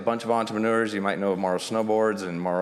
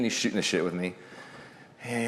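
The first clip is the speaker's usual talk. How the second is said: ordinary delivery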